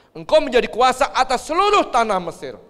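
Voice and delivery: loud, high royal proclamation; Firaun. Acted. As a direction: proclaim it forcefully, as a ruler granting power. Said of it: "Fir'aun ngomong kepada Yusuf". (titah raja yang lantang dan tinggi)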